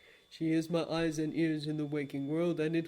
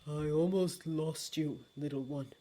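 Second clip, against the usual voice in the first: hushed, broken tenderness; anguished relief; 4 semitones lower, 5 dB quieter than usual. Acted. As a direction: hold back tears as you say it. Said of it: "His voice was racked anguish".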